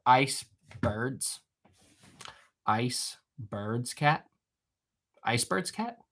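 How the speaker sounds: drawn-out, halting word with a questioning rise; slow, doubtful query; puzzled repeating. Real, not acted. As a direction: say it slowly, baffled, waiting for an answer.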